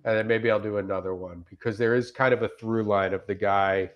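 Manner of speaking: usual speaking voice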